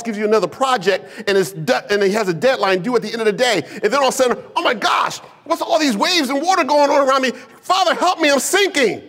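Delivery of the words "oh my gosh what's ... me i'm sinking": high-pitched acted panic; panicked. Overwhelmed worker pleads for help as troubles pile up, acted panic. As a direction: cry out for rescue, pitch far up.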